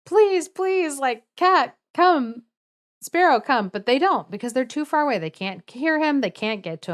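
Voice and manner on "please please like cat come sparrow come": high-pitched mock scream for help; retold screaming. Brave youth dragged away, calling his friends, mock screaming. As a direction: plead in a high, imitated cry.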